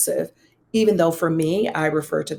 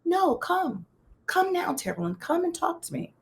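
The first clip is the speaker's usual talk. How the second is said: higher and softer, a gentle invitation; gentle coaxing; 11 semitones higher, 6 dB quieter than usual. Retold or imitated. Pastor urges Terrell into her suite, soft high coaxing. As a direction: coax a scared friend inside softly.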